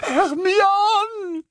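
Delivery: very high, loud, drawn-out howl; Ron's desperate scream. A narrator's reading. Prisoner calling to his tortured friend overhead, desperate scream. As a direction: scream her name in anguish. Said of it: "hurla Ron".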